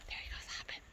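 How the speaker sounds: hushed whisper near animals; wildlife watching whisper. Real, not acted. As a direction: whisper so you don't scare it. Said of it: "Trying to be quiet so we don't scare him".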